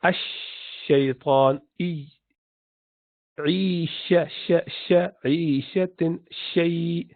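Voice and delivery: ash-shaytan slowed down, with a long sh; showing the spread shin sound; shin demo. Shown as a demonstration. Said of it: "small gap you leave there".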